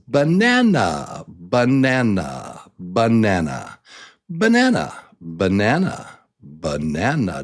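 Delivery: banana as ba-NAN-a; repeated banana, each with a strong middle; stressed middle syllable. Shown as a demonstration. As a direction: peak on NAN in banana, then fall. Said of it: "Do you hear it?".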